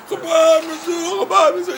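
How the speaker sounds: mock screaming of the slogan; imitated screaming. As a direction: scream it into his ear. Visitor yelling slogan, imitated.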